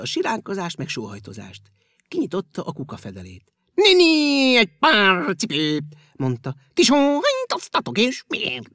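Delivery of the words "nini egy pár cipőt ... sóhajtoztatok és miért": loud, high bouncy voice; a springy creature peering into a bin. Acted. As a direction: chirp with curiosity. Springy creature finds shoes.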